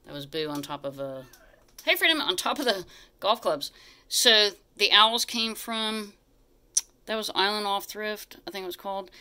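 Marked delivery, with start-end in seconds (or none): none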